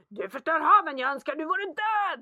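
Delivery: loud retold child's outburst; mock yell. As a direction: shout it like an angry kid. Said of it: "skriker han". (härmat barnskrik, högt)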